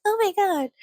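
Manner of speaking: high-pitched mock horror; mock victim. Neighbor scared of chickens looking at her, mock horrified. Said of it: "she just plays the victim card".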